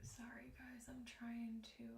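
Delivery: soft whispered aside to viewers; real whisper. Real, not acted. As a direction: murmur it under your breath while busy. Apologising mid-setup under her breath.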